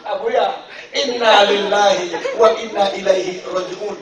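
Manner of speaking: slow, loud drawn-out mourning chant; solemn mock lament. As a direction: intone it like a funeral prayer. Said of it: "Udah dia ingat lillahi".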